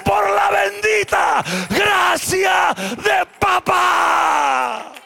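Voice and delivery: shouted repeat. Shown as a demonstration. Pastor repeats the phrase to the congregation, slow, loud and high. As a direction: stretch it out and shout it to the rafters. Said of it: "vuelvo a decir".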